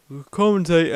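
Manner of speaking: word stretched by a yawn. Real, not acted. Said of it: "and I yawned".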